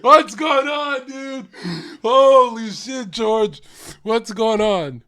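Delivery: loud, high, drawn-out disbelief; hammed-up appalled outcry; mock shock. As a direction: stretch each exclamation in exaggerated alarm. Mock outrage at reported insult.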